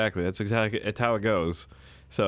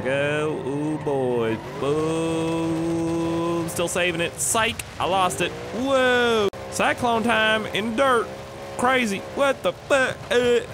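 high, rising whoops as control slips; excited hollering; 7 semitones higher, much slower than usual. Real, not acted. Gamer trying to save a spinning car, excited hollering. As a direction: holler through the skid, pitch climbing.

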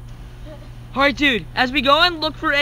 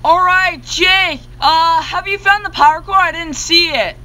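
calling out; 6 semitones higher, 6 dB louder than usual. Real, not acted. Calling partner across map.

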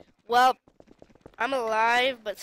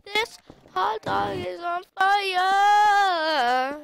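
whiny wail; 7 semitones higher, 7 dB louder, much slower than usual. Real, not acted. Repeats the hot dog line.

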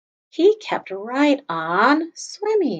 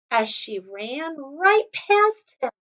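ordinary delivery

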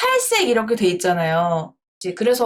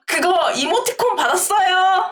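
excited bragging; 9 semitones higher than usual. Real, not acted. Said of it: "자랑해야지".

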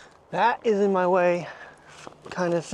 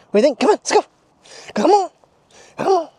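much higher, louder coaxing call; excited dog call; 10 semitones higher, 6 dB louder, faster than usual. Real, not acted. Owner calling his dog to come along, excited. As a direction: call the dog brightly, urging her on.

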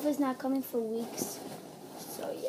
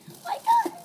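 much higher, louder squeal; excited squeal; 7 dB louder than usual. Real, not acted. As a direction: squeal it out with joy. Opens more items.

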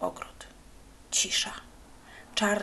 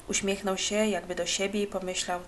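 ordinary delivery

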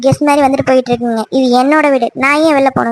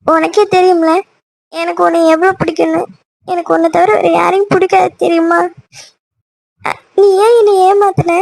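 higher, crying reproach; tearful; 4 semitones higher than usual. Acted. Girlfriend accusing her boyfriend of betrayal, tearful. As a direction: plead through tears, hurt and accusing. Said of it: "எதுக்கு இப்படி அழுதுட்டு இருக்க".

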